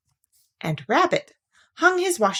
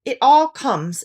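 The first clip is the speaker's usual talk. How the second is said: slow, louder, a touch cross; mildly cross; 6 semitones higher, 6 dB louder, slower than usual. Acted. Pooh blaming the small front doors, mildly cross. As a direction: grumble it with mild annoyance. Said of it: "said Pooh crossly".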